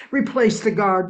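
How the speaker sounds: a loud, angry demand to replace the guard; the crowd shouts at the top of its voice; yelling crowd. Acted. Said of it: "they yelled".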